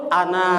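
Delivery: "ana" with a single short n; correct pronunciation. Shown as a demonstration. Teacher correcting a common misreading, correct pronunciation. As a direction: say "ana" evenly, without doubling the n. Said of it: "Yang betul".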